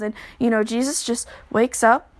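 usual speaking voice

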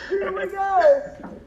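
high, drawn-out race-start call; excited race call. Pretend. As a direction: sing out the start like the lights just dropped. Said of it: "He wants to hear you put it on there".

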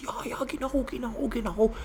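high-pitched, eager agreeing teacher; excited high mock-teacher voice. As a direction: mimic a teacher gushing in a high voice. Imitates the teacher gushing about Porsche.

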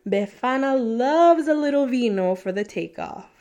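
usual speaking voice

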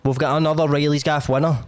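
a chatty sponsor read in his own voice; podcast ad read. Acted. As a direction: sell the sponsor like a cheeky mate. Host reads sponsor ads.

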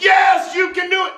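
high, loud crowd cheer on the first word. Retold or imitated. Crowd calling back to the tightrope walker, eager cheer.

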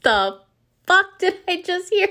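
high, loud, incredulous outburst; stunned disbelief. Retold or imitated. Passenger reacting to a girl's shocking confession, stunned and high.

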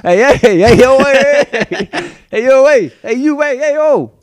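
party hype chant; pumped-up party call to a stranger; hyped greeting. Pretend. Hyping a mock dance greeting.

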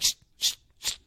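quick unvoiced shh, shh, shh; repeated shushing. Teacher shushing the students over and over. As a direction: keep shushing them. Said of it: "And then she's like".

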